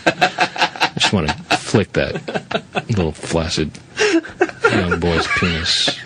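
low, slow creepy whisper-laugh; lightly voiced creepy old man. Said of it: "in Brent's voice, I believe him".

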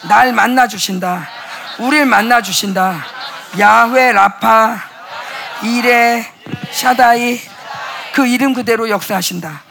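rising preacher's cry; proclaiming shout. Real, not acted. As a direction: call out the names of God. Preacher proclaims God's names.

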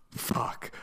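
breathless whispered curse; a horrified whisper of the one forbidden word; gasped whisper. Retold or imitated. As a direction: whisper it in shock after a gasp.

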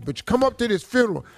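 ordinary talk

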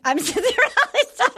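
high, laughing mock quote. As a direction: squeal it out through a laugh, mocking. Mocking a cast member's text.

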